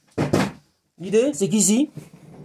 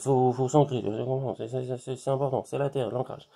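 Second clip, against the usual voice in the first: quiet; 5 semitones lower, 8 dB quieter than usual. Real, not acted. Breathing, grounding talk.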